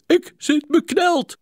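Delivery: high, loud, slow cry of panic; desperate slow shout; panicked cry. A narrator's reading. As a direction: cry out trapped. Trapped doctor cries out.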